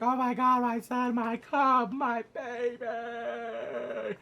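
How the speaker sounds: loud, high hysterical mother. Acted. Mother crying out over injured son, hysterical. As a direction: wail it, dragging out the last word. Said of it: "Alicent's going hysterical".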